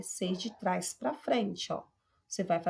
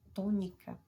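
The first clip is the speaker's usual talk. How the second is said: ordinary talk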